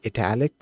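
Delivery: ITALIC said "ih-TAL-ic"; italic with short I. Shown as a demonstration. Coder recalling what they called it growing up, ih-TAL-ic pronunciation. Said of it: "growing up, we just called it".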